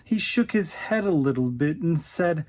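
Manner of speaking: ordinary delivery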